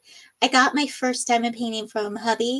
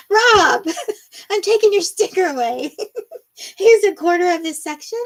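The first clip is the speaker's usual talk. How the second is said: high and loud, giggling through mock indignation; laughing mock scolding; 6 semitones higher, 5 dB louder than usual. Real, not acted. Streamer laughingly punishes a teasing viewer, giggly mock scolding. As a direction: scold the chat while cracking up.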